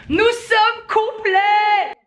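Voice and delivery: triumphant high shout; excited shout. Real, not acted. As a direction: shout the good news with joy. Announcing sold-out show.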